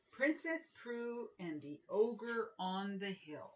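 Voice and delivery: put-on lively picture book reading for children; animated children's book narration; storybook read-aloud. A narrator's reading. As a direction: read it like story time with kids.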